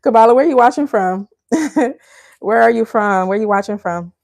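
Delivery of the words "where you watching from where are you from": ordinary delivery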